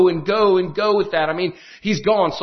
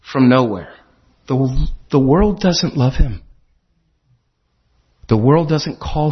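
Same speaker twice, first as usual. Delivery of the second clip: preaching; 5 semitones lower, 4 dB louder than usual. Acted. Pastor preaching to his small congregation, fervent and loud. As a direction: preach it to the little chapel, firm and full. Preacher contrasts world and chapel.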